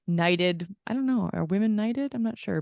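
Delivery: ordinary talk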